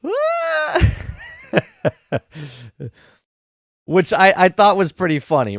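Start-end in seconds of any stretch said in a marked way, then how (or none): 0.0-0.9 very high held comic scream; high-pitched mock shriek; mock startled scream. 1.8-2.5 short, quiet chuckle; amused little laugh at your own bit; real chuckle. Real, not acted. Laughs after own mock scream.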